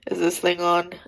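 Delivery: sudden loud mock mic check; loud, playful is this thing on; joking loud check. Real, not acted. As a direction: boom it out like testing a mic.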